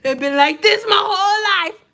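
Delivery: high, loud plaintive holler; loud mock wail. Streamer insisting to viewers about eyebrows, loud mock wail. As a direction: cry it out in hammed-up protest.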